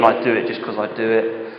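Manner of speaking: a half-hearted reluctant churchgoer; bored churchgoer. Retold or imitated. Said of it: "slump into church, dragging your feet".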